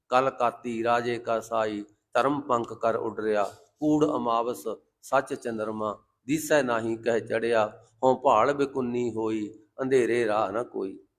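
slow, low, evenly measured verse; unhurried recitation of holy verse; scripture recitation. Acted. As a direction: recite the sacred lines slowly, pitched low. Quotes scripture.

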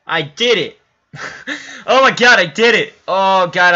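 loud, high cry of triumph; excited shout. Real, not acted. Gamer shouts after a lucky hit, excited.

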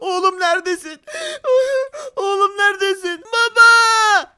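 high, loud mock-desperate calling; calling for lost son. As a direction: wail out for your missing child, hammed up. Father searches for kidnapped son.

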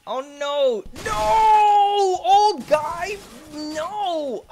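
high, loud screaming no; panicked shout. Real, not acted. Player yelling as an old man ruins the run, screaming.

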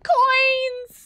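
excited shout. Real, not acted. Spots coins in the game.

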